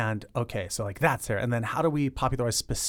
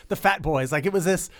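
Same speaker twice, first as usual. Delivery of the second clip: ordinary talk, nothing marked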